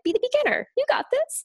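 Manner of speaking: high, squeaky cheerleading; encouraging shoulder voice. Acted. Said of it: "on the other shoulder was like".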